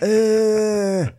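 high squeaky "Eh"; imitated yelp. Cohost repeating the yelp noise. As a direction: chirp the yelp back. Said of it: "doing his accent".

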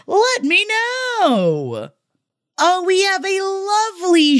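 high, very slow singsong. Acted. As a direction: stretch it out high and playful.

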